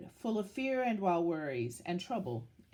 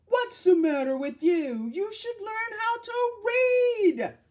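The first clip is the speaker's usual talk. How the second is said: loud, high shouting drivers; angry yell; 13 semitones higher, 9 dB louder than usual. Acted. Angry driver yelling at the boy who stepped out. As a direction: yell it at the careless kid. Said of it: "One yelled".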